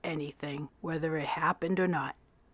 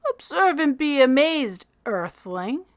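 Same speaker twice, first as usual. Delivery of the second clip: grand announcement; 7 semitones higher, 4 dB louder than usual. A narrator's reading. Freak shows off his bird.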